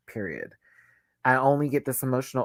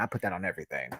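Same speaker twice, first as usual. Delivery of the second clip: quiet vow; 5 semitones lower, 9 dB quieter, much faster than usual. Real, not acted. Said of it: "That is a true statement".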